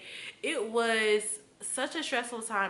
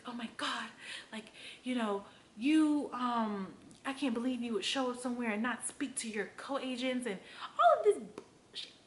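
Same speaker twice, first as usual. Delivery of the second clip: mock whiny broker; 4 semitones higher than usual. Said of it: "she was just like".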